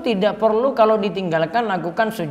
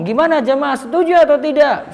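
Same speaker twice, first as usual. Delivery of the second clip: higher, louder call to the congregation; mock preacher asking the crowd; 8 semitones higher, 5 dB louder than usual. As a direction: rouse the crowd like a showy preacher. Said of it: "Jangan sampai ada refleks jamaah ngomong". (meniru khatib yang mengajak jamaah bicara)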